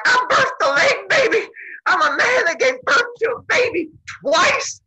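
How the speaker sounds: very high, loud triumphant crowing; incredibly proud. Retold or imitated. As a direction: shout it like a boastful new parent. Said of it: "Zeus is incredibly proud".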